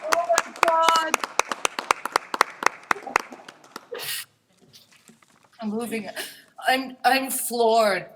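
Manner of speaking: teary, laughing through tears; choked up and overwhelmed, high; ugly crying. Real, not acted. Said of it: "I have an ugly crying face".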